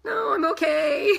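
high, hammed-up squeamish no thanks; mock-sweet dodge of the offer; polite refusal. Turning down a friend's baby, mock polite high refusal. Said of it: "And I was kind of like".

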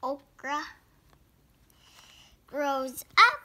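slow sing-song storybook read-aloud; picture book reading. Acted. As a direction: read each page like a picture book for little kids.

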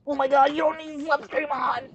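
very high, squealed mock exasperation; high, strained whine of disbelief; high-pitched outburst. Mock outburst at a teammate during game.

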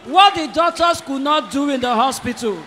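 loud, rising preacher shout; preaching. Acted. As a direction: preach it hard to the congregation. Pastor preaching at full force.

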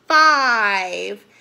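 loud, drawn-out number reveal; pause, then boom the number out; loud announcement. A narrator's reading. Storyteller revealing the number to children, loud.

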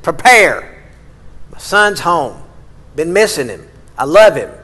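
loud, bursting announcements; joyful proclamation. Acted. Father announcing his son's return, loud and overjoyed. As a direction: shout the good news to the house.

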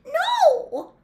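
very high, loud squeal of horror; horror-struck squeak. A narrator's reading. Hermione realises her mistake, horror-struck squeak. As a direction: squeak it out, aghast. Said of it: "in a horror-struck squeak".

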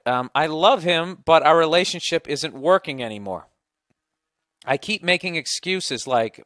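loud, emphatic reading aloud; email read-out. Acted. Host reading a woman's relationship complaint, emphatic read-out. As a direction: read it out forcefully, leaning on key words.

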